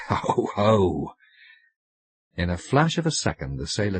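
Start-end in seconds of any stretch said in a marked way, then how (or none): 0.1-1.1 mocking laugh. A narrator's reading. Sailor laughs at her claim.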